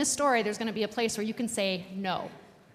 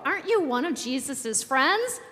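higher, louder storybook challenge; lightly voiced servant girl, asking pointedly; questioning servant girl; 5 semitones higher, 6 dB louder than usual. A narrator's reading. Pastor voices servant girl questioning Peter, challenging.

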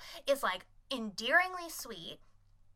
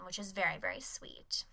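breathy whispered gush; whispering how sweet it is; soft whisper; 3 semitones lower, 7 dB quieter than usual. Real, not acted. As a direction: hush it like a secret. Gushing over a sweet scene.

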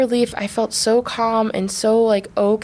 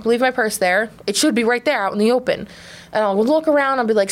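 normal talking voice

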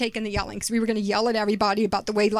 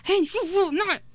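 high-pitched mock angry yell; ugly yell; 8 semitones higher than usual. Mother showing how she yelled at her kids, ugly mock yell. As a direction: re-enact a parent losing it, pitched high. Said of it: "the ugly kind of yell".

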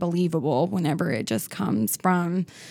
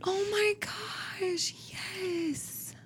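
breathy swoon; 12 semitones higher, 11 dB quieter, much slower than usual. Real, not acted. Melting over dog photo.

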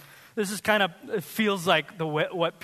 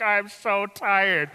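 exhausted drag; 4 dB louder, much slower than usual. Retold or imitated. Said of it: "the rest of us are like".

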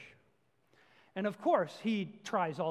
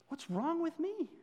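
self-rebuke; 9 semitones higher than usual. Real, not acted. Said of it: "I'm talking to myself really right now".

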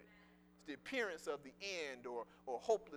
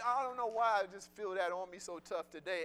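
usual speaking voice

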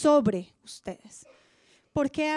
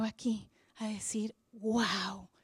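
soft unvoiced whisper, drawing listeners in; hushed awe; 4 semitones lower, 8 dB quieter, slower than usual. Real, not acted. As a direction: whisper the promise close. Intimate promise to congregation.

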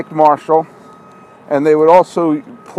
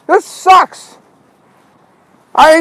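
frustrated; 11 semitones higher, 4 dB louder, much slower than usual. Real, not acted. Can't find car or name.

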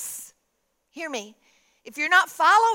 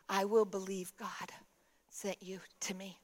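soft and low, fading to a whisper; hushed, moved reply; 5 semitones lower, 11 dB quieter than usual. Retold or imitated. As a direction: imitate a moved woman, nearly whispering. Moved woman answers after prayer.

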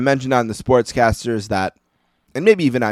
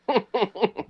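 laughing; 11 semitones higher, 5 dB quieter than usual. Real, not acted. Laughing at grandma's curse.

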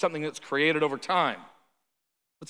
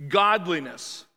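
ordinary delivery

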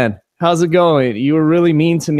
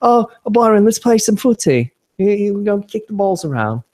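higher put-on footy-lad voice; pitched-up comic character voice; mock footy player; 5 semitones higher than usual. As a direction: play at being a football club lad. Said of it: "You have to say football".

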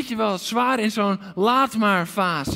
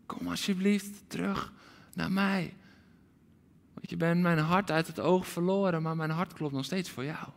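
softer, tender pleading; tender plea; 7 dB quieter than usual. Acted. God the Father pleading softly with his children. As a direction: speak like a longing father. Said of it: "die zegt".

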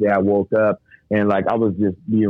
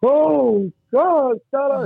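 high, wailing cry to God; re-enacted tearful outburst, drawn out; imitated crying pastor; much slower than usual. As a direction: wail it far above your own pitch. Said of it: "I've never seen him cry".

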